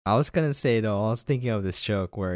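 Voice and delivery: usual speaking voice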